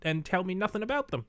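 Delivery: ordinary talk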